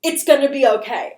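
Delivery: a hint of unsettling calm turning pushy; eerie insistent reassurance. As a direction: repeat it with creepy insistence. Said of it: "keeps saying".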